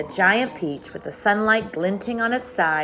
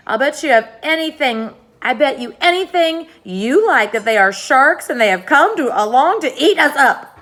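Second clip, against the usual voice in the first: higher, louder frantic insisting; panicked; 7 semitones higher, 9 dB louder than usual. Acted. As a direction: babble it out in a panic. Earthworm panics about sharks.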